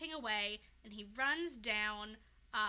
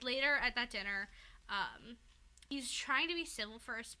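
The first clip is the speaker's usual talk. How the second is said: normal talking voice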